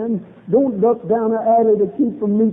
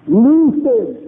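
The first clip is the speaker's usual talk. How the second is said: higher and much louder order; ringing command; 3 semitones higher, 6 dB louder than usual. Acted. Jesus ordering the crowd to unbind Lazarus, ringing command. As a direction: bark the order to set him free.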